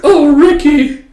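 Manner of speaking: hammed-up swoon; swooning sigh. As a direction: sigh his name like a romance heroine. Swooning girl voiced.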